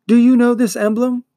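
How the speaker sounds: high, loud, urgent demand; bold challenge. Acted. Atreyu challenges the monster.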